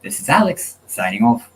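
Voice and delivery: playful, wobbly fake Australian accent; attempted Australian accent. Host giving final thoughts to the audience, fake Australian accent. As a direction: sign off in a badly faked Australian accent. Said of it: "Hope you don't mind the accent".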